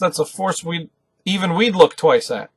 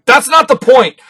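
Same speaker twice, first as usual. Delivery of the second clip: higher, louder indignant shout; angry outburst; 10 semitones higher, 7 dB louder, slower than usual. Retold or imitated. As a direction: snap back, offended. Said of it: "Always mad".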